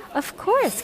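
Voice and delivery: high-pitched cry of sudden understanding; cried out. A narrator's reading. Don catches on to Father's riddle, crying out. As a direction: exclaim it as it dawns on you. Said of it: "cried Don".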